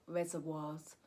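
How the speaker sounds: normal talking voice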